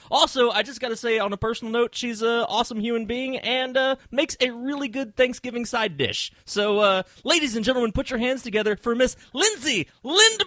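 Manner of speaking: high, rising showman build-up; grand entrance announcement. Acted. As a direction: present her like a ringmaster. Introducing guest to applause.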